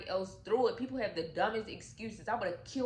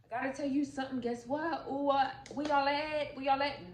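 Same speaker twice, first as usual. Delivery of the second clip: high, eager call through the house; calling out happily for her family; excited calling out; 6 semitones higher, faster than usual. Retold or imitated. Wife coming home calling for her family with excited news.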